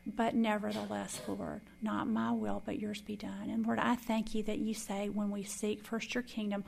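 loud, earnest praying voice; loud heartfelt closing prayer; fervent prayer. Acted. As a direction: pray it aloud with conviction.

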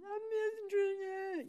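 high, loud mock-sobbing wail; imitated crying. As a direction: wail it as if bursting into tears. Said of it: "that made me cry some more".

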